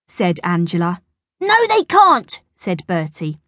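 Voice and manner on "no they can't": high, loud schoolboy squabble; acted childish contradiction, pitched high; bossy retort. A narrator's reading. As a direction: shut the other child down. Bertie contradicts Angela.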